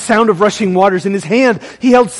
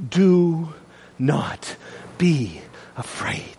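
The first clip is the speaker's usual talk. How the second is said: very soft, low and slow; gentle reassurance; 5 semitones lower, 10 dB quieter, much slower than usual. Acted. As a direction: hush it, pausing between words to calm the fallen. Jesus gently reassures fallen John.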